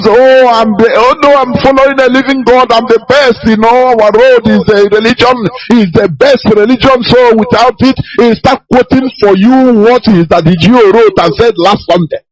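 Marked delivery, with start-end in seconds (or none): 0.0-7.9 high, boastful mimicry of a know-it-all; mocking the fool. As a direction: lightly voice a smug believer. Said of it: "But the fool thinks that he knows".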